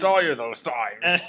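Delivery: loud, slow hammy accusation; Mel. Retold or imitated. Co-host echoes Mel accusing Ricky, overblown accusation.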